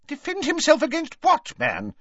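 high-pitched, exasperated challenge; indignant. A narrator's reading. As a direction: demand an answer, outraged. Ogden indignantly challenges Gaunt.

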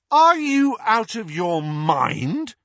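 Harry demanding of his uncle. A narrator's reading. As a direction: challenge him outright. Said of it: "demanded Harry".

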